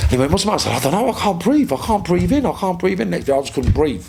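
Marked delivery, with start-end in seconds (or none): 0.3-0.7 alarmed friend. Retold or imitated. Acted worried friend in story. 0.9-3.1 high-pitched breathless panic, repeating quickly; panicked, can't breathe. Acted. Acts out panic, unable to breathe.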